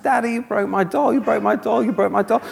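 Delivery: put-on high, plaintive little-girl voice; mock crying child. Little girl accusing Daddy over her broken doll, mock crying. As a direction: imitate a tearful child, repeating the complaint. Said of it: "this little girl starts crying, crying, crying".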